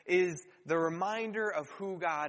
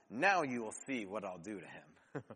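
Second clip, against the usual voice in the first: deep, far-off voice of God, much lower; 9 semitones lower, 4 dB quieter than usual. Retold or imitated. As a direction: speak as God, low and ominous. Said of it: "And God's response is".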